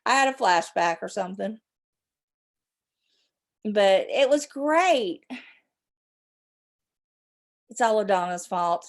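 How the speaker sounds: ordinary delivery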